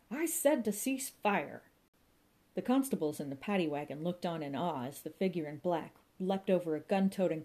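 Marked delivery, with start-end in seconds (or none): 0.1-1.6 high, slow, commanding shout for a ceasefire; commanding call. A narrator's reading. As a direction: call out slowly over the gunfire, ordering. Inspector calling for a ceasefire.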